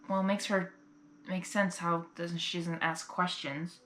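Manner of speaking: ordinary delivery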